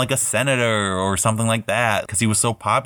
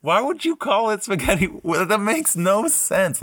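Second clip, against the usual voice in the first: mock indignation; 11 semitones higher than usual. Mock outrage at "spaghetti western.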